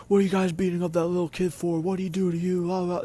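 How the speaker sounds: high, loud scolding yell; an older guy yelling at bullies; retold yelling. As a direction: chew the bullies out. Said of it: "he starts yelling".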